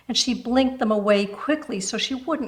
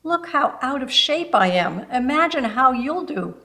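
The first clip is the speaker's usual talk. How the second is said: put-on higher, lively older lady; cheerful invitation; 6 semitones higher, 3 dB louder than usual. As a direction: show off and draw her in.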